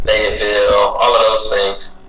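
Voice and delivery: ordinary talk, nothing marked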